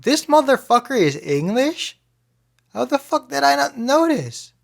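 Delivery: high-pitched, incredulous outburst; retold astonishment. Podcaster recalling discovering an actor is English, astonished.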